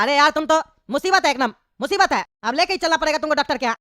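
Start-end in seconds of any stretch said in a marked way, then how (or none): none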